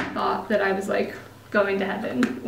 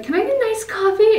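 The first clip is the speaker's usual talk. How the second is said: sweet polite order; 11 semitones higher, 4 dB louder than usual. Retold or imitated. Ordering at Dunkin' Donuts.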